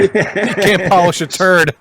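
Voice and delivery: laughing through it, high, loud and slow. Real, not acted. Host laughs, correcting the guest's saying. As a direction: crack up while getting the words out.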